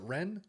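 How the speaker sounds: Rennes as a questioning "ren?"; one-syllable Rennes. Real, not acted. Host checking how to pronounce it, Rennes as "ren?". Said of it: "Is that how you pronounce it?".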